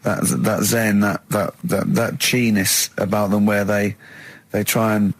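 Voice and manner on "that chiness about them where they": ordinary talk, nothing marked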